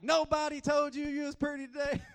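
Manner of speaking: high, loud playful astonishment; mock disbelief. Dad to daughter Rosalie, playful mock astonishment. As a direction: act shocked to tease her. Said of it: "I'm like".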